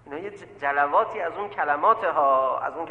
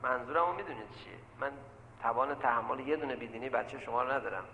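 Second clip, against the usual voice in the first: normal talking voice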